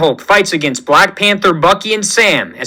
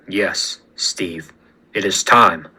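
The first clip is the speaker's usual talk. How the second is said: soft, low, half-whispered reply; hushed resolve; 11 semitones lower, 6 dB quieter, slower than usual. Acted. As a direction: breathe it out, ready for the fight. T'Challa answers Steve before fight.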